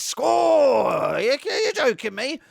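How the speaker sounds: long high roar of a goal cry; drawn-out, loud and high; celebratory shout. Pretend. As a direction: yell it like the ball just went in. Mimics celebrating under the lights.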